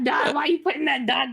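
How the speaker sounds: ordinary talk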